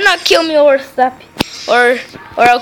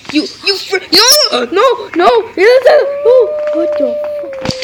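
high, loud cries of alarm; frantic gamer shriek; panicked yelling; 13 semitones higher, 6 dB louder, slower than usual. Real, not acted. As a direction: shout in panic as you get attacked. Attacked in game.

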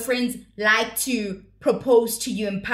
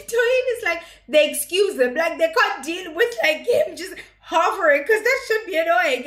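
higher, talking through giggles; laughing; 6 semitones higher than usual. Real, not acted. Vlogger recapping the bodyguard scene through laughter. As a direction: speak while still laughing.

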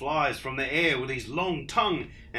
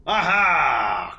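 much louder triumphant aha; triumphant cry; 4 semitones higher, 11 dB louder than usual. Acted. Dingo cries out in triumph. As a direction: burst out with discovery. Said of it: "cried Dingo".

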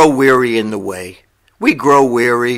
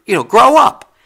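louder and higher, a curt scold; blunt scolding; 7 semitones higher, 5 dB louder than usual. Real, not acted. Preacher scolding easily offended listeners, blunt. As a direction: tell them flatly to grow up.

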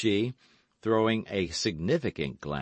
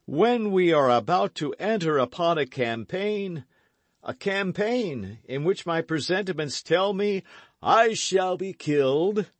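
a hint of mock gloom, pitched up; put-on melancholy; 11 semitones higher, 5 dB louder than usual. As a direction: sigh out a hammed-up premonition. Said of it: "in the most melancholy tone he could assume".

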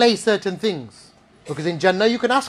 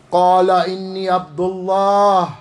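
measured recitation; 3 dB louder, faster than usual. Acted. Preacher quoting the newborn's first words, measured recitation. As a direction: recite it steadily in your own register, stretching the last word. First take, against baby voice.

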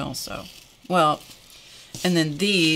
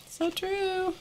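much higher, squeaky so true; squeaky agreement; 6 dB quieter than usual. Pretend. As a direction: squeak it out playfully. Agreeing with a viewer comment.

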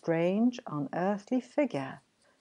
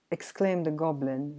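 ordinary delivery, nothing marked

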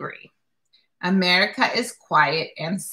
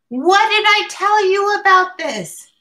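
a sharp, much higher scolding father; angry father; 12 semitones higher, 4 dB louder than usual. Acted. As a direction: scold her like an angry dad. Father scolds daughter for writing.

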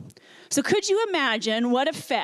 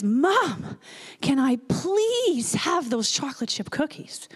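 a hint of a small boy asking, please stretched; lightly voiced pleading child; slower than usual. Little boy asks Mom for cookies from the cart, mild pleading. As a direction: plead lightly like a hopeful three-year-old. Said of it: "the little guy stands up in his cart and says".

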